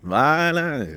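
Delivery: the aha moment of remembering a place name; sudden recall. Real, not acted. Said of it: "ça m'est revenu". (haut et soudain, ça lui revient)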